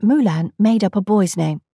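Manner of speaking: ordinary delivery, nothing marked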